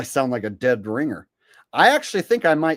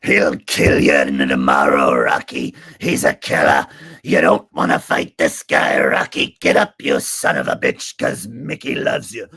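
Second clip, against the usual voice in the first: much lower, louder gravel-coach growl; gruff Mickey voice; 11 semitones lower, 6 dB louder than usual. Retold or imitated. Rocky recalls coach Mickey yelling, much lower and louder. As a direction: bark it like an old trainer. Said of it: "Sylvester Stallone impression".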